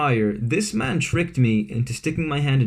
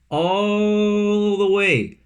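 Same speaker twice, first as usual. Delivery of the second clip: drawn out; 7 semitones higher, much slower than usual. Real, not acted. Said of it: "stretching the long drive".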